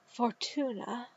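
a soft, hushed question; half-whispered, barely voiced. A narrator's reading. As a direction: breathe the name out in fear. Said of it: "she whispered".

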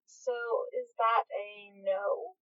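soft, slow and tentative; hesitant. A narrator's reading. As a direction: ask it shyly, almost whispered. Aziraphale shy, hesitant question.

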